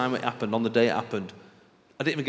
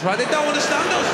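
high, raised-voice protest; exasperated outburst; 13 semitones higher, 4 dB louder than usual. Acted. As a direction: cry out in baffled exasperation. Exasperated outburst after retelling.

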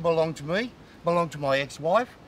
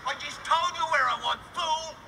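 high-pitched angry yelling; real anger, pitched right up; furious outburst; 10 semitones higher than usual. Real, not acted. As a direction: shout in exasperation at being pestered. Losing temper at the reporter.

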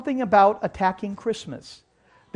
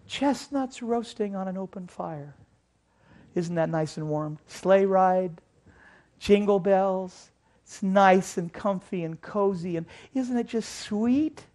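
mock-sweet; 6 dB quieter than usual. Mocking cozy Christmas warm fuzzies.

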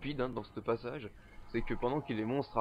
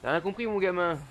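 taunt; 6 semitones higher, 8 dB louder than usual. Real, not acted. Taunting enemy.

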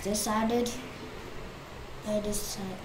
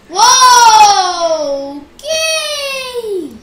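startled cry; 7 semitones higher, 14 dB louder, much slower than usual. Real, not acted. Jump scare in game.

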